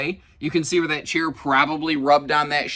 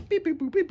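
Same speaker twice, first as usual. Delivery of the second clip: high, light imitated button beeps; playful beeps; 4 dB quieter than usual. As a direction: chirp like a kid poking buttons. Kids pressing buttons, imitated beeps.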